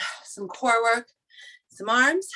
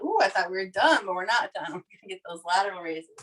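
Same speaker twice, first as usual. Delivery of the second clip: ordinary talk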